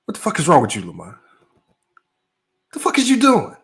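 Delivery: hammed-up shocked scolding; retold angry friend. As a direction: imitate a friend yelling at you over the phone. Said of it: "He said".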